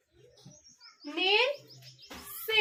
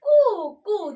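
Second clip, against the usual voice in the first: high, loud cooing like a pigeon; 3 semitones higher, 6 dB louder than usual. Shown as a demonstration. Teacher showing students the pigeon's sound, cooing. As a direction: coo like a pigeon for the class.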